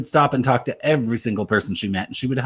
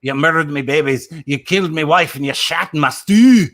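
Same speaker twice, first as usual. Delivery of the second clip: hammed-up, outraged Scottish cry; best Scottish accent; 4 semitones higher, 4 dB louder than usual. Shown as a demonstration. Actor redoing an old stage line on request, Scottish accent. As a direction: rant in a thick Scottish accent, higher and louder. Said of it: "that was in my best Scottish accent".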